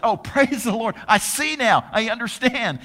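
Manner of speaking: high, loud, laughing mock praise; mock joyful Pilate. Preacher joking what Pilate did not say, mock delighted. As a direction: ham up a delighted convert with a laugh.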